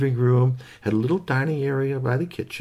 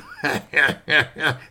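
mischievous squirrel giggle; gleeful sneaky laugh; 2 semitones higher than usual. Acted. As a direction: snicker at your own trick.

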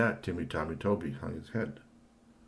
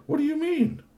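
squeaky high question; 8 dB louder than usual. Acted. Talltail's sudden high question.

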